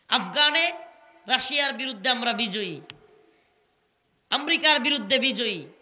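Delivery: rising, loud victory chant, each name hammered; fiery preaching. Acted. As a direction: preach it at full force to the crowd.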